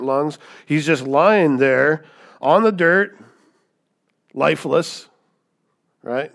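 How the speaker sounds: loud, slow sermon build-up; preaching at full force. Acted. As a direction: drive each image home to the congregation.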